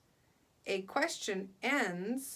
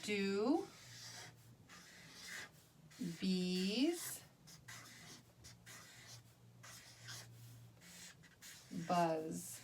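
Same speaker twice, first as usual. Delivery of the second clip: each word said alone with long pauses, softer; word by word dictation; 2 semitones lower, 9 dB quieter, much slower than usual. Shown as a demonstration. Teacher dictating each word to the class while writing. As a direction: spell it out word by word as you write. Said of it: "dictating while writing".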